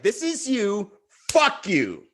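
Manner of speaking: high-pitched mock outburst; imitating her attack. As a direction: hurl it as a retold attack. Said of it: "an emotional".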